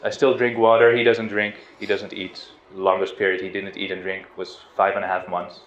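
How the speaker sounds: ordinary talk, nothing marked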